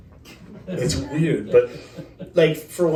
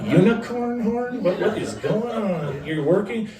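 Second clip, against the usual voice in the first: mock baffled; 6 semitones higher, slower than usual. Retold own baffled reaction.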